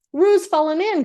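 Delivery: high alarmed cry; cried out. A narrator's reading. Rabbit crying out to everyone in alarm. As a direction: call out in alarm that Roo fell in. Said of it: "cried Rabbit".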